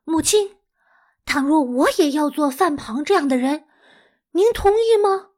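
put-on high, eager boy's plea; lightly voiced excited young Su Dongpo; excited child. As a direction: ask mother with a child's excitement. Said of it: "激动地说".

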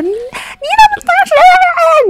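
very high, drawn-out mock tirade; nonstop scolding. Friend scolding the delivery man over the phone, ranting. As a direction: imitate someone ranting without a break. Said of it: "郭老师就一直骂他".

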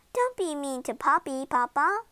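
ordinary delivery, nothing marked

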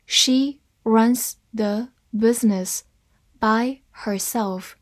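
slow, word by word; deliberate teaching pace with gaps between words; slow reading. Shown as a demonstration. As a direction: separate each word clearly. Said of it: "慢慢来读".

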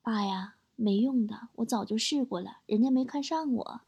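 put-on soft, earnest child's confession; earnest little son. Son tells dad about the girl at kindergarten, quiet and earnest. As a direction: break it to dad quietly and seriously. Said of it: "儿子很认真地告诉我说".

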